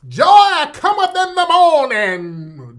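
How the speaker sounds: loud, high, drawn-out Southern preacher cadence; mock Southern Baptist accent. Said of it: "these accents".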